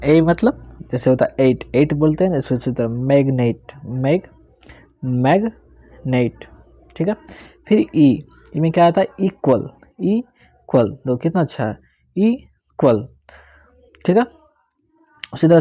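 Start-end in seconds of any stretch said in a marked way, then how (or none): 2.4-6.4 मैग्नेट stretched very slowly, vowel held long; slow classroom drill; pronunciation demo. Shown as a demonstration. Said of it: "जैसे मैं बोल रहा हूँ वैसे आपको भी बोलना". 9.2-11.0 इक्वल said twice, slow and higher; teacher modelling a word; pronunciation demo. Shown as a demonstration. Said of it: "जैसे मैं बोल रहा हूँ वैसे आपको भी बोलना".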